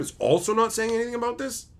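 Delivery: usual speaking voice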